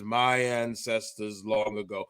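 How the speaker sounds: portentous mock-regal intoning; grand Egyptian king. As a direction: drop into a solemn ancient-ruler voice. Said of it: "from Vernon Somoza to the Egyptian king Xerxes".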